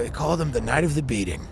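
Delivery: deadpan calm press statement; calm Biden. Pretend. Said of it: "Biden says it very calmly".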